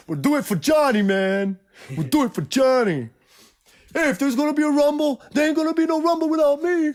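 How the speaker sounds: loud, high-pitched movie tough-guy imitation; rally the gang in a hammed-up cry; Matt Dillon. Imitating an Outsiders hero before the rumble, hammed-up shout. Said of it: "Matt Dillon, I remember he".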